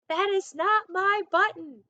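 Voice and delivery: high, loud storybook shout. Acted. Toad shouting at Frog by the muddy river. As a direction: shout it out, fed up. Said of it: "shouted Toad".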